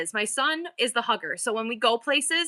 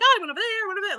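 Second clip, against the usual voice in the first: breezy high reply; 10 semitones higher, much faster than usual. Retold or imitated. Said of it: "I'm like".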